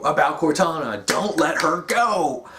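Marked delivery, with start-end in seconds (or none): 1.0-2.5 slow, drawn-out, higher-pitched quote; mock dramatic plea. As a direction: plead it slowly, hammed-up. Quoting a plea about Cortana.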